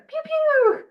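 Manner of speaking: high, playful laser zaps; imitated pew-pew. Speaker handing over to a returning co-speaker, high playful pew-pew. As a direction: zap it out high, the last one drawn out.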